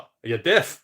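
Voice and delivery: loud mock Glaswegian accent; terrible Scottish accent. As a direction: do a bad, broad Glasgow bouncer accent. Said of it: "that was a terrible Scottish accent".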